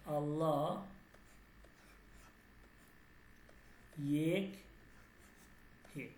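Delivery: আল্লাহ এক হে spaced word by word, long pauses between; teacher spells it out slowly, pausing after each word; slow careful demo. Shown as a demonstration. Teacher showing students the pronunciation, slow and spaced out. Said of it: "এটা উচ্চারণটা হচ্ছে এইভাবে".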